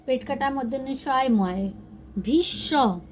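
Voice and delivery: ordinary talk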